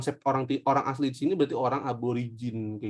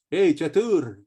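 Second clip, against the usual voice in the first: calling out; 3 semitones higher, 5 dB louder, much slower than usual. Retold or imitated. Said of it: "gitu kan".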